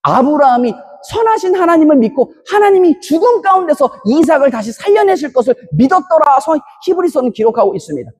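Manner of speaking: ordinary delivery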